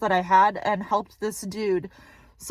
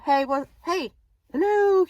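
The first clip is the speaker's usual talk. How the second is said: high sing-song hello to get his attention; calling out; 6 semitones higher than usual. Retold or imitated. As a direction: call out to a stranger walking past. Calling out to a passer-by.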